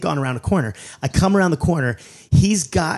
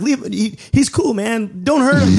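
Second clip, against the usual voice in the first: higher-pitched, urgent plea; mock pleading, getting louder at the end; retold pleading; 8 semitones higher, 4 dB louder, slower than usual. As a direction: plead with the cops, voice climbing. Pleading with cops in story.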